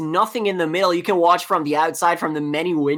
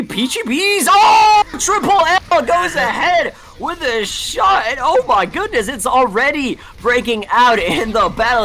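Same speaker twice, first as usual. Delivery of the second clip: excited shout; 7 semitones higher, 4 dB louder than usual. Real, not acted. Caster calls the big fight.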